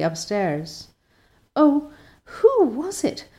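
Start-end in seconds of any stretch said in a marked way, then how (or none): 1.6-3.2 frightened whisper. Acted. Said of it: "whispered Anne".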